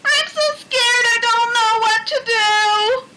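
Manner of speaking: scared crying. A narrator's reading. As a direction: plead for help, near tears.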